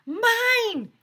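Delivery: high, loud eager shout. A narrator's reading. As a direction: yell it like every kid wants first turn. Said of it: "everyone shouted".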